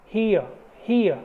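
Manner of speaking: हियर as hee-uh with no r; ear sound demo. Shown as a demonstration. Teacher models हियर for the class, no r. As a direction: say हियर gliding ee to uh, r silent. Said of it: "ई और अ".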